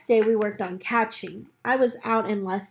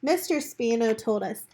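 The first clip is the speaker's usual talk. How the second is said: usual speaking voice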